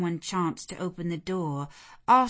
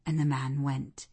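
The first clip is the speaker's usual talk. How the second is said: plain reading voice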